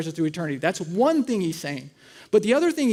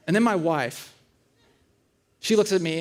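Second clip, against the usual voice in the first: ordinary talk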